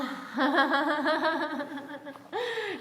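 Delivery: long, loud burst of giggling laughter; hearty laugh. Real, not acted. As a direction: burst out laughing mid-instruction. Breaks up while guiding class.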